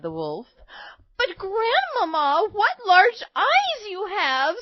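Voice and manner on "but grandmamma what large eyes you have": put-on high, slow girlish amazement; astonished little girl. A narrator's reading. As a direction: marvel in a high small-girl voice.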